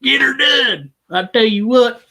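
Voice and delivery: hammed-up Southern good ol' boy; Larry the Cable Guy. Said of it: "do some Cable Guy jokes".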